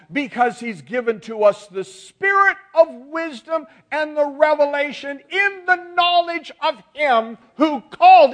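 drawn-out, swelling pulpit cadence; soaring preaching. Real, not acted. Preacher assures those who pray with Christ, soaring oratory. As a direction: stretch the key words slowly, building in force.

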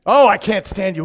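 retorting spouse in a mock car quarrel; mock snappy retort. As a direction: toss it back in a fake huff.

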